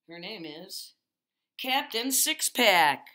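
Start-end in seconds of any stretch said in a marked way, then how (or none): 1.6-3.1 slow, loud, drawn-out announcer call; big announcer reveal. Acted. Host introducing the guest with a slow, booming announcer call. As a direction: boom out the guest's name like a ring announcer.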